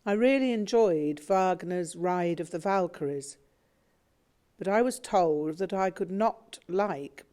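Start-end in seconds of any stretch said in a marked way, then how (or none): none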